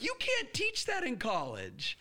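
high, slow amazement; amazed punchline. Retold or imitated. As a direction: marvel at the lesson.

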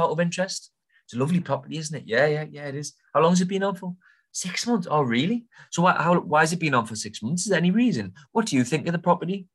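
keen buyer gently probing the agent; friendly enquiry. Shown as a demonstration. Demonstrates a friendly call to agent.

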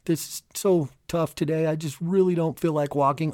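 whiny inner voice. Retold or imitated.

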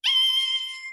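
imitated shrill ringing in the ears; very high, loud whine; high piercing tone. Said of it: "my ears go".

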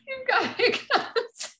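slow, laughing through it; amused, giggling reply. Real, not acted. Laughing at partner's hiccups.

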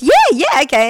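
wife mimics neighbour grabbing the wine offer, high and loud; mock eager neighbour. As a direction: ham up his eagerness. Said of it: "And he goes".

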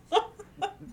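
high real burst of laughter. Real, not acted. Laughing at herself about hugging a stranger, real laugh. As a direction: let a real laugh spill out. Laugh after hug confession.

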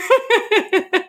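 high-pitched burst of real laughter. Real, not acted. Laughs at her own eagerness.

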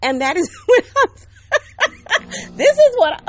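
high, loud laughing through the words; real laughter breaking the sentence. Real, not acted. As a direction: laugh at your own folly. Laughing at herself.

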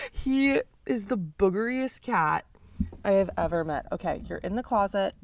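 ordinary talk

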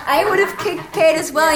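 high, animated indignation with laughter; riled up. Real, not acted. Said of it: "I was angry too".

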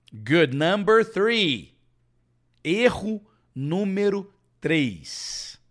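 slow loud high announcement; announcing heading. Real, not acted. Teacher announcing error three to class, slow and loud. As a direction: call out the next point slowly.

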